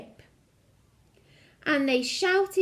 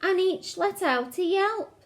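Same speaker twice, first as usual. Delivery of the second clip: ordinary delivery, nothing marked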